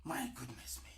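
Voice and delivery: a hushed, shocked whisper; whispered aside. Real, not acted. A shocked speaker whispering to himself, a hushed whisper. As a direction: breathe it out in a whisper.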